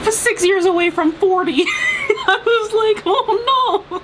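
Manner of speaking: high laughing half-shout. Real, not acted. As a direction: laugh it out in dismay. Laughing dismay at age.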